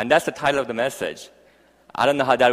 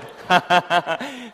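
high burst of laughter; amused, high-pitched chuckling; laughing; 7 semitones higher than usual. Real, not acted. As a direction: laugh heartily at your own joke. Jokes about own accent.

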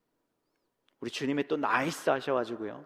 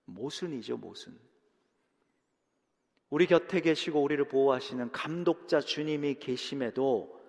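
ordinary talk, nothing marked

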